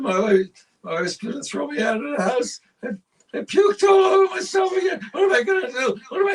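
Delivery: high, loud hammed-up drunken wail; crying drunk. Husband blubbering to the bartender, loud comic crying. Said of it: "he's crying".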